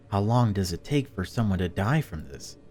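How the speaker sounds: ordinary talk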